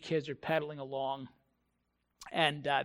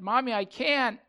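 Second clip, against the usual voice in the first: put-on higher, louder childish protest; mock kid's plea, pitched up; whining little kid; 6 semitones higher, 7 dB louder than usual. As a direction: imitate a child whining at mom. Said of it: "the younger of the two kids say".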